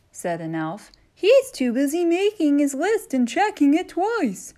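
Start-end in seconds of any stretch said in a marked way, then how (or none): 1.1-4.5 high, louder bossy elf; christmas elf. Acted. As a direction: brush off the visitor. Elf voiced.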